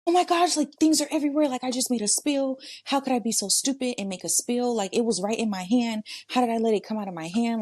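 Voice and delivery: high, rattled self-scolding; super frustrated. Acted. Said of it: "I'd get super frustrated 'cause I'm like".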